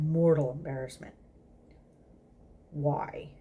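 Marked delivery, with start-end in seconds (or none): none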